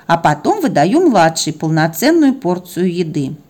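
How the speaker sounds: ordinary delivery, nothing marked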